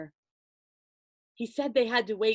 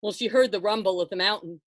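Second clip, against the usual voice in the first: plain reading voice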